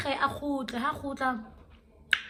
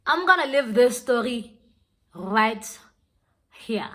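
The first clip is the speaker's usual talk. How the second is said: loud, deliberate sign-off with pauses; emphatic announcement; 7 dB louder, slower than usual. Real, not acted. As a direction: announce it firmly, setting RIGHT and HERE apart. Repeats sign-off, now emphatically.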